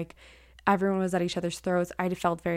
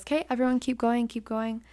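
lightly voiced instructor, pitched higher; a hint of a teacher calling, tailing off; teacher's prompt; 5 semitones higher than usual. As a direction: lift the voice to steer a class onward. Imitating teacher steering the class on.

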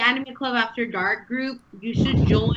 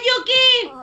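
excited shout; 11 semitones higher, 6 dB louder than usual. Real, not acted.

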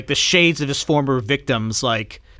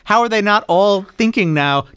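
ordinary delivery

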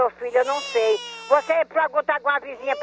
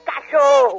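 louder, real indignant snap on cachorro; angry insult; 6 dB louder, much slower than usual. Real, not acted. Annoyed woman tells off the prank caller, louder and angry. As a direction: spit the insult out, fed up.

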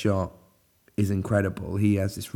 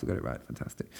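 breathy self-congratulating whisper; whispered aside; 10 semitones lower, 12 dB quieter, much faster than usual. Real, not acted. Reviewer quietly congratulating himself on the composer's name, whispered. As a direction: whisper it to yourself, pleased.

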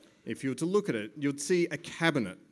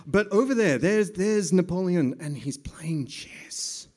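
put-on French accent; 5 semitones higher, 5 dB louder than usual. Mock French scene narration.

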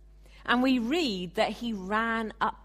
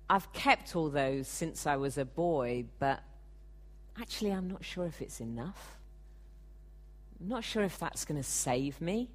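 softer, doubtful and uneasy confession; regretful; 3 semitones lower, 6 dB quieter than usual. Retold or imitated. Preacher voicing the rich ruler admitting doubt to Jesus, quietly regretful. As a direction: let the confidence drain out, unsure it's enough. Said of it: "Or was there a tone of regret".

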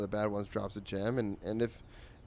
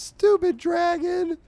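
high, slow, much louder wail of a sobbing princess; bratty princess wail; 10 dB louder, slower than usual. Acted. Crying princess shouts at the dragon, high drawn-out wail. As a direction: stretch it out like a tantrum cry.

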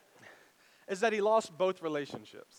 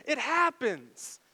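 normal talking voice

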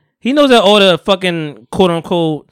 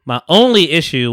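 "only" punched up high and louder; stress on "only"; 3 semitones higher, 4 dB louder than usual. Real, not acted. Restating his one issue with J I D, heavy stress on "only". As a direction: capitalize "only" with your voice. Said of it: "capitalize on only".

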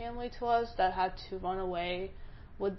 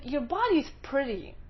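mocking quote; 3 semitones higher, 7 dB louder than usual. Mimicking the rude guy.

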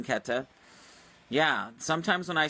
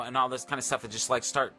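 normal talking voice

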